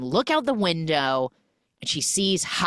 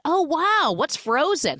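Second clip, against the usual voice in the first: high, loud clueless amazement; imitated innocent surprise, pitched up; naive wonder; 12 semitones higher, 4 dB louder than usual. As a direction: act impressed but totally in the dark. Retold man clueless about Frozen.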